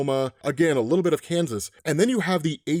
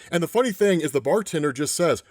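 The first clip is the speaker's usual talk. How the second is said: ordinary delivery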